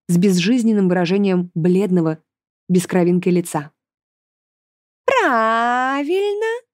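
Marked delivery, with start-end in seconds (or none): 5.2-6.6 very drawn-out word rising high; sneering, stretched-out demon approval; mocking demoness. A narrator's reading. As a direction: taunt slowly in a high villainess voice. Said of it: "издевательски сказала Берклея".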